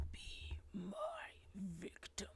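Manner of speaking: breathy whispered Tony Todd line; imitated Candyman whisper. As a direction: whisper it like a horror villain leaning in. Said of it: "if he comes up to me and says".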